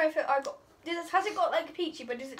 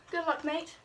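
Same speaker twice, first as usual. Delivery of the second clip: usual speaking voice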